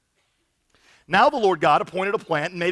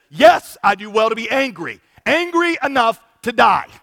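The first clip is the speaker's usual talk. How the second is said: louder, defiant and bitter; angry Jonah; 4 semitones higher, 7 dB louder than usual. Acted. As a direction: hurl it back at God in anger. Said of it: "And Jonah said".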